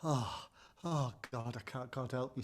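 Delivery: drained, begging for mercy, hushed; weary plea. Retold or imitated. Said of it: "And he said".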